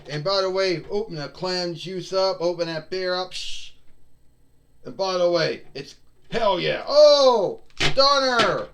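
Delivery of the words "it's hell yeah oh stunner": much louder, higher hammed-up yell; catchphrase shout. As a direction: roar the catchphrase.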